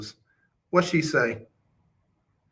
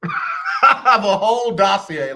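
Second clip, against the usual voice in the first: laughing through the line, slowed; 3 semitones higher, slower than usual. Real, not acted. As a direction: repeat it while cracking up.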